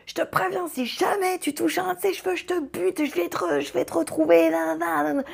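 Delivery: furious threat. Retold or imitated. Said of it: "je l'entends hurler".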